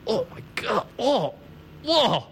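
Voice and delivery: high, hushed breathy gasps of astonishment; can barely get the words out for wonder; stunned amazement. Retold or imitated. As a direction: act out someone awestruck, voice leaping up. Acting out Esther's stunned reaction.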